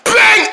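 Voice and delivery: loud high "Bang!" sound effect; punch sound. Acted. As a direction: shout the punch noise. Punching the crate.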